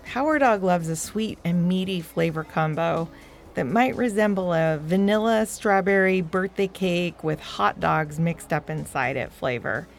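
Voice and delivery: usual speaking voice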